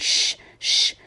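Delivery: unvoiced shushing; two long hushing sounds. A narrator's reading. Hatter and March Hare silencing Alice, hushing. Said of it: "the Hatter and the March Hare went".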